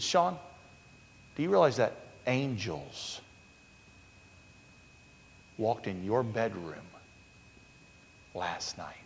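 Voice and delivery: lightly voiced Holy Spirit. Holy Spirit consoling a grieving husband, hushed revelation. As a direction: reveal it piece by piece, long pauses, fading soft. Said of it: "the Holy Spirit said to me".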